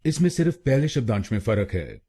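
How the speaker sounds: usual speaking voice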